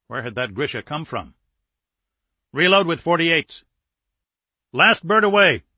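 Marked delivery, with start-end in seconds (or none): 2.5-3.6 higher, firm order; military command. Acted. Captain orders tubes reloaded, firm command. 4.7-5.6 high, loud military callout; announcing. Acted. Missile technician reports last launch, loud announcement. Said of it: "the missile technician announced".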